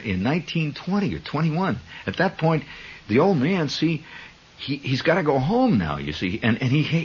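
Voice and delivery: ordinary delivery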